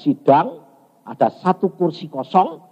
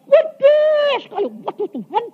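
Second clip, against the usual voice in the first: awestruck. Retold or imitated. Preacher marvelling to God at a huge palace, awestruck. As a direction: cry out high at the sheer size.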